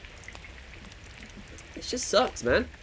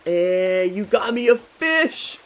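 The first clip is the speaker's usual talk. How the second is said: higher, louder, slow delighted cry; thrilled by the gift; delighted at a friend giving a fish; excited; 7 semitones higher, 5 dB louder, much slower than usual. Real, not acted. Game friend gives him fish.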